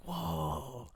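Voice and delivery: soft, drawn-out whoa; hushed amazement at the sight; awed whoa. Retold or imitated. Acting out awe at new ceiling.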